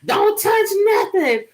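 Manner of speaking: high sharp scolding shout; mother's scolding shout. Retold or imitated. Mother scolding her grown daughter, sharp shout. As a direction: snap the order like a strict mom. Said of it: "mother repeats warning".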